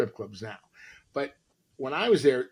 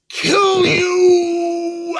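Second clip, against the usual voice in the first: much higher, loud, slow yelled threat; threatening shout; 11 dB louder than usual. Retold or imitated. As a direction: roar the threat at the guy.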